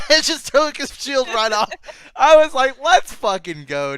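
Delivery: high, very loud fan excitement; real exhilarated yelling; hyped-up cheering. Real, not acted. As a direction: shout it like the winning blow just landed. Fan hyped retelling the fight.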